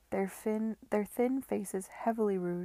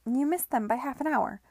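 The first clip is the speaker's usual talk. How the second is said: high, loud cockney brothel keeper, all welcome; loud, high, pushy landlady voice; brassy madam; 5 semitones higher, 7 dB louder than usual. Acted. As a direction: sell him the new girls with a broad grin.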